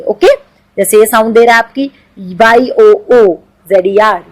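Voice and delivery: ordinary talk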